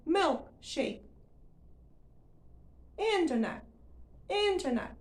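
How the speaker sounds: milkshake and internet with the final consonants dropped; suppressed endings. Shown as a demonstration. Teacher demonstrating the second tip. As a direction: cut the ends off milkshake and internet.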